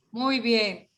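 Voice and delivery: normal talking voice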